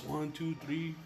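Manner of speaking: quiet counting. Real, not acted.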